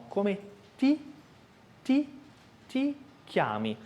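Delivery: ti repeated, crisp T against the upper teeth; dry T drill. Shown as a demonstration. Teacher repeating ti to show the T. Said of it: "no céu da boca, próximo aos dentes".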